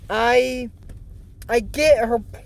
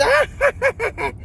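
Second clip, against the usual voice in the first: squeaky high voice, stretched slow; high creepy voice; 3 semitones higher, 3 dB quieter, much slower than usual. Retold or imitated. Vlogger mimics a girl hearing the witch cackle, in a high squeaky voice. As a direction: pitch it way up and drag it out like a startled girl.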